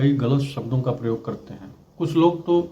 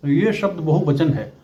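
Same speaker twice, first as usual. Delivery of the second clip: ordinary delivery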